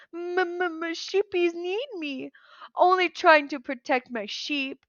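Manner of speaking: high, stammering sobbed plea; sobbing giant. Acted. Cyclops begs Percy through sobs, stammering. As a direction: blubber it like a big crying child. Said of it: "He began to swan, sob".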